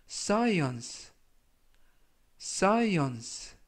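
science read carefully as sai-ons, each falling; model pronunciation. Shown as a demonstration. Teacher modelling the word for students, careful pronunciation. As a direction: say science clearly for learners to copy. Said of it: "science라고 발음하시면".

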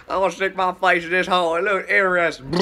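tripping goofy voice. Retold or imitated. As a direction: play it like a tripping kid with a silly idea. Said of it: "well, how it went is I was like".

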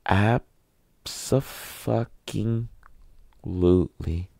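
drawn out very slow, each syllable stretched; seething emphasis. Real, not acted. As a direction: stretch it out like you're barely holding it in. Answering whether he's fuming.

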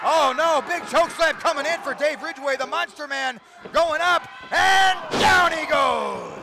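excited play-by-play. Real, not acted. Commentator calling a choke slam as it lands, excited shout. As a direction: build to a loud, stretched-out payoff.

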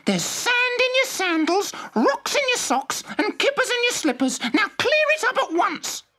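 high, sharp scolding mother. A narrator's reading. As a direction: tell off the messy children. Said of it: "snapped their mother".

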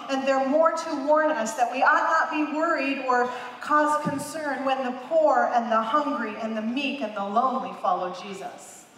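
ordinary talk

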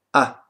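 "A" as a short open "ah"; vowel A. Shown as a demonstration. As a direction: clip out a lone "A" as the vocalized R. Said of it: "sondern nur".

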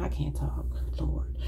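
hushed, exasperated aside; under the breath, barely voiced; whispered self-reproach. Real, not acted. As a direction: whisper it to yourself after a stumble. Stumbles over words, mutters to self.

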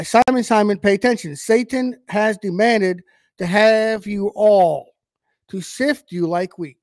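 preacher reading Jesus' words; scripture reading. Acted. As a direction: read the verse out to the congregation with weight. Reads scripture aloud.

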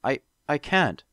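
slow, halting, on the verge of tears; near tears. Acted. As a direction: choke the words out, about to cry. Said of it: "I felt like crying".

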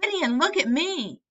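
put-on a little boy shouting for attention; excited cry. A narrator's reading. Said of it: "There he would cry".

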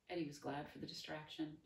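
usual speaking voice

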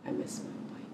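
very low, barely voiced murmur; hushed confession. Soldier admitting she misses her spouse, hushed. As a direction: let it out almost under your breath.

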